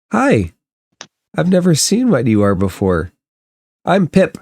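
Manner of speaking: hint of a curious small child; little boy. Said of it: "this little seven-year-old boy comes up".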